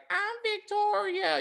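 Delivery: a creepy falsetto reveal in her dress; Jason as Victoria. Retold or imitated. Jason in his mother's dress announcing himself, slow high put-on voice. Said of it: "Jason's gonna come out in her dress and be like".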